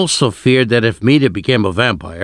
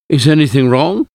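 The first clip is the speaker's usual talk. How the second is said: a higher, louder startled question; alarmed; 5 semitones higher, 4 dB louder than usual. A narrator's reading. Narrator woken before dawn asks Mina in alarm. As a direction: ask in sudden alarm. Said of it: "I asked, alarmed".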